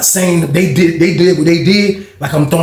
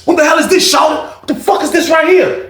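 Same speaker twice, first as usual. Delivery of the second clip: angry shout; 12 semitones higher than usual. Retold or imitated. Angry player yells at the card cheat, shouting high. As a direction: blow up at the cheat.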